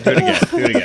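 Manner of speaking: high, loud, excited urging. Real, not acted. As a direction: egg them on, thrilled.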